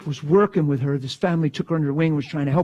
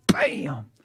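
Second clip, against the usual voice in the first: ordinary talk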